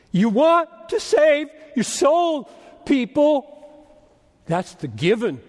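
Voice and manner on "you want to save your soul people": high, slow preacher's cry; drawn-out, high-pitched appeal; impassioned exhortation. Real, not acted. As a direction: plead with the congregation, pitch raised.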